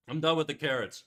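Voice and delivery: usual speaking voice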